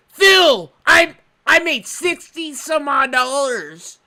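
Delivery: loud call of the name; yelling at Phil. Real, not acted. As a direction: shout the name across the room. Calling out to Phil.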